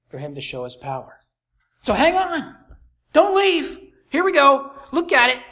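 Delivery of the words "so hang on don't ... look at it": urgent. Real, not acted. Preacher rousing congregation not to leave.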